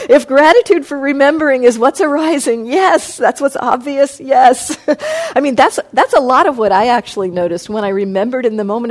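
bright, laughing yes-yes. Real, not acted. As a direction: laugh through the words. Laughing while affirming a question.

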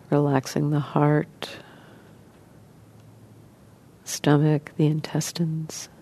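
soft, even voice with long pauses; guided meditation. Acted. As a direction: gently guide listeners into relaxing, leaving space between phrases.

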